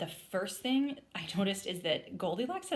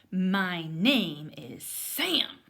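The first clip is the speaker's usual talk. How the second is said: slow, louder, each word pushed out; stubborn insistence; 8 dB louder, much slower than usual. Acted. Cub insists on his own name, slow loud and stubborn. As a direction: stamp your foot on every word. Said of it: "I stamped my foot".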